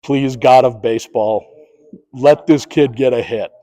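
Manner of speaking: low, soft mock prayer with a laugh; praying. Umpire silently praying the batter gets a hit, low and soft. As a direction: pray under your breath, half joking. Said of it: "guess what I'm doing?".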